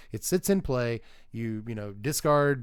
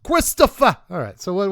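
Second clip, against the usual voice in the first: shouted mobster call of a name, much higher and louder; Sopranos impression; 6 semitones higher, 10 dB louder than usual. Streamer calling out in a loud mobster voice. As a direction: bellow the name like a Jersey mob boss. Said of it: "That is my impression of The Sopranos".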